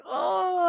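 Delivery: high mock groan of reluctance; exaggerated "oh"; imitated groan. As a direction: groan as if you know what's coming. Staff groan at familiar advice.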